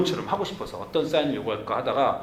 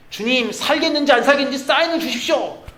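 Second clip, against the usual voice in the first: pleading prayer; 8 semitones higher, 4 dB louder than usual. Pretend. Pastor voices his own prayer.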